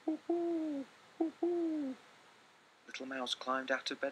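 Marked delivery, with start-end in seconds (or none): 0.0-2.0 much higher hooting call; owl hooting. Acted. Owl calling outside the mouse house, high hoots. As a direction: hoo like an owl in the night. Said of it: "someone calling".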